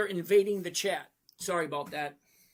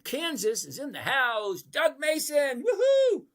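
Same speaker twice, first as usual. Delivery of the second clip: excited shout-out; 8 semitones higher, 7 dB louder, slower than usual. Real, not acted. Greeting a viewer from Kansas.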